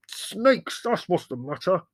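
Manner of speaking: high, loud, angry snarl; enraged outburst. A narrator's reading. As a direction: throw the answer back in fury. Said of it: "snarled Leslie".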